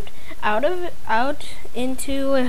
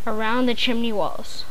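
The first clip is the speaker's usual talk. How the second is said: ordinary delivery, nothing marked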